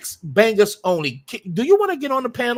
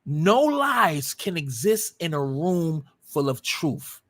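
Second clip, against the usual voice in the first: slow and lowered, word by word; measured, weighty delivery at a lower pitch; slow, emphatic maxim; 4 semitones lower, slower than usual. Real, not acted. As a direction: spell out the maxim slowly so it lands. Maxim restated slowly for weight.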